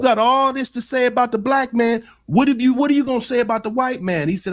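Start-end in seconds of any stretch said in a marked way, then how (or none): 0.0-2.0 higher-pitched imitation of a challenger; retold challenge. Retelling how Corey confronted Anton on his platform, challenging. 2.3-4.3 quick, high-pitched imitated demand; retold challenge. Retelling Corey pressing Anton for an answer, challenging.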